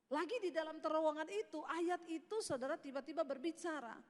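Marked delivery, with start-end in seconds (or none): none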